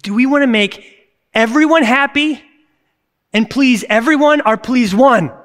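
fervent preacher pushing the crowd; preaching challenge. Real, not acted. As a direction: press the question with force.

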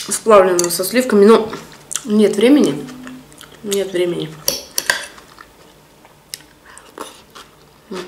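talking between bites; words muffled by food; mouth full, chewing. Real, not acted. Seen 1: Takes forkful at 3 s, chews visibly while speaking throughout.

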